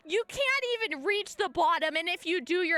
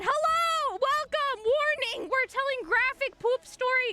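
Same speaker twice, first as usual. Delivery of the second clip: high bright announcer lilt; mock announcement; 7 semitones higher than usual. Real, not acted. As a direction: announce it with a wink.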